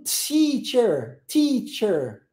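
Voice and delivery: "Teacher" with a cymbal-like "ts" onset; exaggerated crisp T in "Teacher"; English T. Shown as a demonstration. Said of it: "Esse tss é o som do T".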